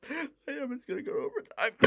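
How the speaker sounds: fighting back real tears, fast and breathless. Real, not acted. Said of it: "I look like I'm about to cry, 'cause I am".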